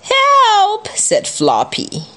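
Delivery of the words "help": very high storybook yelp for help; high-pitched acted call; cry for help. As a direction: squeal it out as a character in danger. Floppy calls for help in danger.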